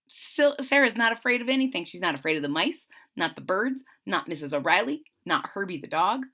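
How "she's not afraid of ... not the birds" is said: ordinary delivery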